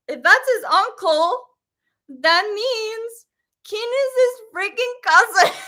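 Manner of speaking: the penny drops, voice climbs; excited realisation. Real, not acted. Realising the twist.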